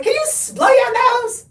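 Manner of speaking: high, loud exasperated mom; nagging mom. Retold or imitated. Mother imitating herself scolding her kids, exasperated. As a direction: snap at the kids to blow their noses. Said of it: "I'm like".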